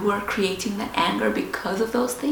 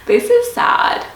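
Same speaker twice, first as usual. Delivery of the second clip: sudden high, loud cry of despair; anguished outburst; 9 dB louder than usual. Retold or imitated. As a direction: burst out as if it all breaks at once. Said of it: "I felt like".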